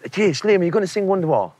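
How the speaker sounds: lightly hammed-up eager punter; pestering fans. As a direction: fans begging Liam for a song, mock eager. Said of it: "they're going like".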